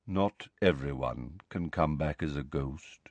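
low, soft reply; hesitant. A narrator's reading. Nick hesitates to disappoint Harry, reluctant and low. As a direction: break it to him softly. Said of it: "Nearly headless Nick hesitated".

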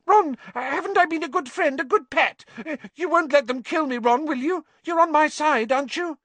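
high, loud, wheedling squeal; desperate pleading. A narrator's reading. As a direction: wheedle for your life in a squeaky voice. Pettigrew begs Ron for his life.

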